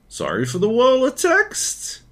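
"text" lifted very high like a question; high mock-question upswing ending on "text"; exaggerated questioning tune. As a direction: turn it into a question, soaring up on "text". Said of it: "you just need a question mark at the end".

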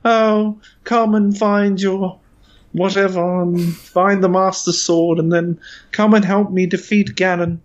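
woeful high-pitched princess voice acting; Zelda. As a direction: plead in a hammed-up, very high heroine voice.

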